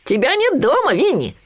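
very high piglet voice; little piglet. A narrator's reading. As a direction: squeak it out as a tiny piglet. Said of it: "сказал Хрюня". (писклявый голосок поросёнка)

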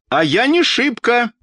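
high-pitched, dignified comeback; dignified retort. A narrator's reading. Old woman answering the doctor below, dignified. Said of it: "с достоинством отвечала Анна Петровна".

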